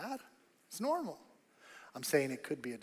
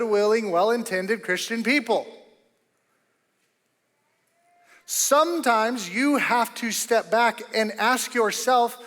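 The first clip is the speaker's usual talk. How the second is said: louder, higher fervent sermon delivery; rally the congregation toward the choice; preaching at full force; 8 semitones higher, 11 dB louder than usual. Acted. Preacher urging the congregation to choose what is needed, loud.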